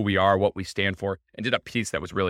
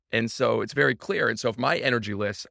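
ordinary delivery

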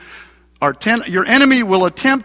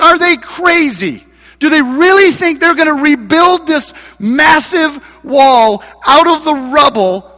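higher, louder sneering taunt; mocking shout; 4 semitones higher, 4 dB louder than usual. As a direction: shout scorn at the wall builders. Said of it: "he's saying in a mocking voice".

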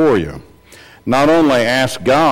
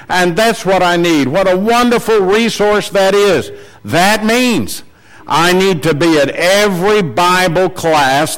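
raised pitch, insistent sermon delivery; emphatic preaching; 7 semitones higher than usual. Real, not acted. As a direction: press each point home to the congregation. Preacher urges congregation at heightened pitch.

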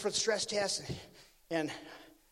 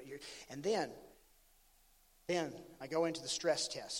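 ordinary delivery

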